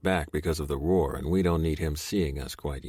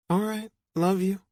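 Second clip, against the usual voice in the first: higher woman's voice, lightly voiced; sleepy girlfriend. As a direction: girlfriend on the phone reassuring her man, higher. Girlfriend ending the call.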